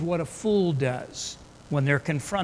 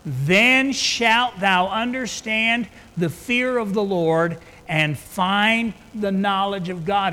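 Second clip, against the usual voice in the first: 1 emphatic preaching; 2 semitones higher, 4 dB louder, slower than usual. Acted. Repeats verse with emphasis.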